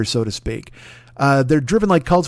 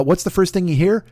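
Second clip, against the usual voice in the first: ordinary talk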